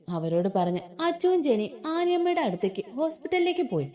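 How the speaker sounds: plain reading voice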